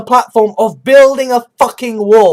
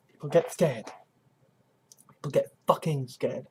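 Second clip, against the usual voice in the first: much softer, lower repeat; quiet repetition; 8 semitones lower, 14 dB quieter than usual. Shown as a demonstration. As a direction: drop to a near hush.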